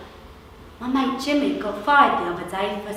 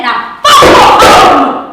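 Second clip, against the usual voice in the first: furious shout; 2 semitones higher, 13 dB louder, slower than usual. Acted. Thug shouts, kicks bike.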